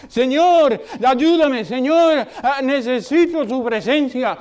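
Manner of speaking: high, loud desperate plea; loud pleading prayer; crying out to God. Acted. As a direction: cry out to the Lord like a thirsty psalmist. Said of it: "clamando a Dios".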